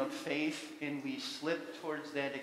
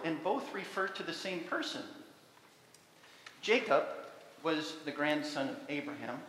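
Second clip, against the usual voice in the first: ordinary talk, nothing marked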